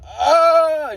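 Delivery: loud, high, sustained scream; high screamed "ah", held long; imitated scream. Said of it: "He was screaming".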